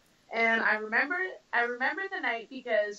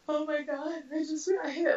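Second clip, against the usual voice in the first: high, teary mock sniffle; replaying the sting of a first punch; sniffly shock; 6 semitones higher than usual. Boxer recalls first hit to the nose, teary sniffling shock. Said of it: "not crying, but, like, sniffling".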